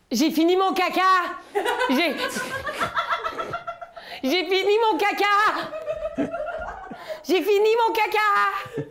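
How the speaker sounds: put-on high, slow giggling toddler voice; cutesy baby talk. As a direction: whine it proudly like a toddler. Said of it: "des moments inappropriés pour parler en bébé".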